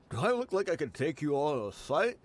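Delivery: ordinary talk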